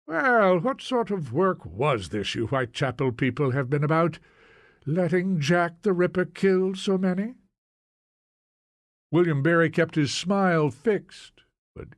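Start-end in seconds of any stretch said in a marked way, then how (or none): 0.1-7.4 high-pitched opening, then a probing question; pry as the Scots landlady, lifting at the end; Scottish landlady, prying. Acted. Landlady asks about the Ripper killings, prying in a raised voice. Landlady probes the Whitechapel newcomers.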